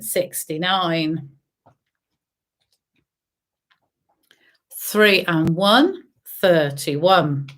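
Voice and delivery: sing-song number calling; bingo caller. Acted. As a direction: call each number out, drawn out. Calls bingo numbers to players.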